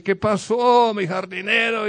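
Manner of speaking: grito agudo y alto de la señora rica; que salte la voz, ofendida y escandalizada; indignación sorprendida. Acted. La señora ve su casita. (la señora rica reclama a gritos al ver su casita)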